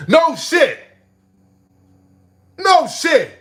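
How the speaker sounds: loud high shout. Real, not acted. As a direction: yell it in disbelief. Shouting in disbelief.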